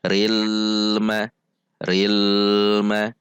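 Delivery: "ril ma" with the l held long; lengthened l. Shown as a demonstration. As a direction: hang on the l of "ril" before "ma". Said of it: "dipanjangkan seperti". ("ril ma" dengan lam dipanjangkan)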